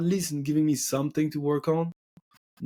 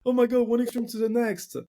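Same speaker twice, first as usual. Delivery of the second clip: mocking girl voice; 9 semitones higher than usual. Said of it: "and she".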